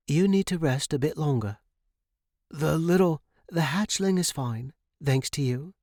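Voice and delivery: put-on a caring older woman reassuring a patient; Mrs Perez Granger voice. A narrator's reading.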